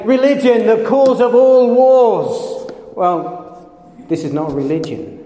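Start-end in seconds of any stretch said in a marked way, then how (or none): 0.0-2.7 higher, louder mock quote; mocking sceptic. Preacher mimics a sceptic's complaint, higher and louder. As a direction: parody the cynic's slogan. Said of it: "And what would we say?".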